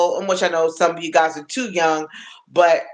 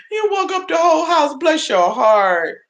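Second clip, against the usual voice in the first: teasing viewer; 10 semitones higher than usual. Real, not acted.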